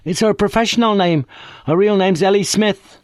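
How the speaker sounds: put-on cheeky working-class girl on the phone; brash young woman. A narrator's reading. Friday Banks answers the inspector, cheeky and common.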